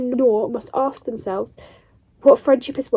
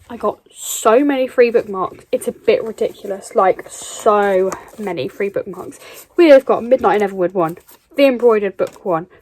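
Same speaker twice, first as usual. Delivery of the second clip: ordinary delivery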